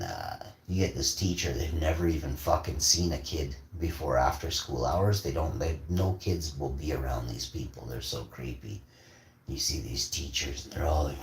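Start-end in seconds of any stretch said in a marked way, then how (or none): none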